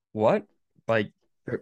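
ordinary talk

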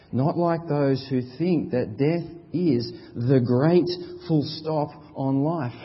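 ordinary talk, nothing marked

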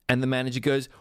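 usual speaking voice

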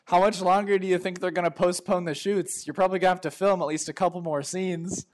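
loud, high taunting call; yelling after someone. Acted. Handler taunts departing crewmate about extra work, calling out. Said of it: "I yell after you".

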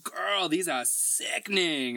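slow, drawn-out sassy praise. Retold or imitated. The nurse praising tattoos, slow and sassy. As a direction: gush slowly like the sassy nurse. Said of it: "She's like".

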